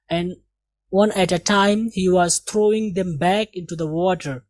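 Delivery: ordinary delivery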